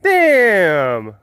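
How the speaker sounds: high-pitched put-on voice of a townsperson; hammed-up villager gossiping about the boy; townspeople voice. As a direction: scoff in a high, silly character voice. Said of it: "people in the town were just like".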